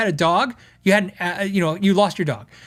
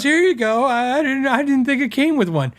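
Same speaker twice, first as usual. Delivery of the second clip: sheepish customer; 5 semitones higher than usual. Retold or imitated. Customer caught returning without cable.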